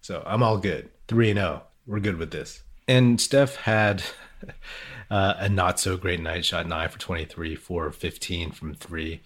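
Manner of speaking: ordinary delivery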